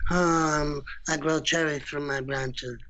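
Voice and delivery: lightly voiced Godfather parody; Marlon Brando. Actor demonstrating his joke voice, Godfather impersonation. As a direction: play a tree as a mob don, lightly. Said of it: "doing a Marlon Brando impersonation".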